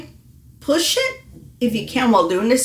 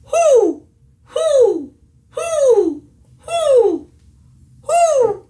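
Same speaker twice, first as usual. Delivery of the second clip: high hoots, louder, relaxed and vertical; owl hoo; 5 dB louder, much slower than usual. Shown as a demonstration. Coach demonstrates the correct vertical hoo. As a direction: hoot high like an owl, mouth kept tall. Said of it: "You're gonna keep it that way".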